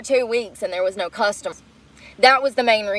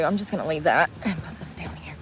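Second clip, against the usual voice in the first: soft, lower whisper to herself; 7 semitones lower, 8 dB quieter than usual. Real, not acted. Sorting the car, whispering to herself. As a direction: breathe the words under your breath.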